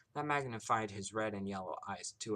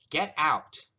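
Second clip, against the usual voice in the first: high, loud, punched command; furious holler; 7 semitones higher, 13 dB louder than usual. A narrator's reading. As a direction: holler at him to leave. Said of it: "she hollered while pointing madly".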